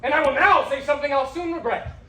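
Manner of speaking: exasperated, emphatic declaration; tired and angry. Acted. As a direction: announce it in rising temper. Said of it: "I am now tired and angry".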